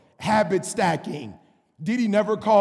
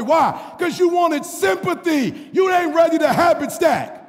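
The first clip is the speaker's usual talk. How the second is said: louder, higher preacher shout; full-force preaching; 8 semitones higher, 6 dB louder than usual. Acted. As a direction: call out the church on their excuses.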